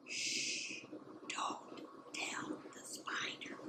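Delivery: soft unvoiced whisper; breathy secret whisper. Shown as a demonstration. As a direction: hush it like a secret. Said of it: "So you get to whisper it".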